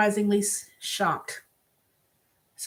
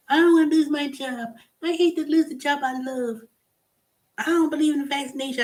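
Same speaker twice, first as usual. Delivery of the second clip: higher, pleading mock whine; mocking whiny teacher; 7 semitones higher than usual. Mimicking a teacher refusing the vaccine, whiny mock plea. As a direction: whine it like a self-pitying employee.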